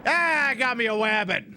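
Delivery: loud, high triumphant whoop; gleeful hunter shout. Pretend. Gamer catching a rabbit, loud triumphant cry. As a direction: crow over the catch like Elmer Fudd.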